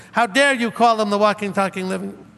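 lightly mocking, whiny know-it-all tone; a hint of a childish sneer; childish mocking whine. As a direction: mimic a foolish critic, mildly whiny. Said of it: "It's a childish, whiny, know-it-all, s-, just foolish".

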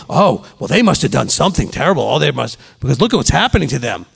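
mock gossip. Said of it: "I've seen people talk like that".

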